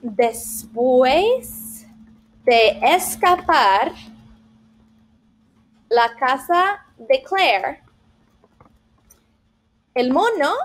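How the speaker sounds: slow, careful Spanish reading; slow demo of a Spanish answer; slow read-aloud. Shown as a demonstration. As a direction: read it like a student typing it out. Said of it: "finish the story where it left off".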